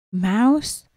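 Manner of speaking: ordinary delivery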